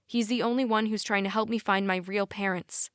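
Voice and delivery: usual speaking voice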